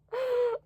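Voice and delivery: sharp high gasp, soft; shocked gasp. Real, not acted. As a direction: gasp in horror. Shocked by cringy review.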